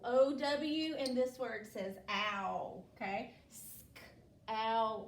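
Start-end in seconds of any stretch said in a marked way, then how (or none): 3.5-5.1 S-k-ow-l split into four sounds, stretched; sounding out slowly. Shown as a demonstration. Teacher repeats the sounds in scowl for the class, slowly. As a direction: segment S-k-ow-l carefully, one sound at a time. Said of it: "let's say the sounds in scowl".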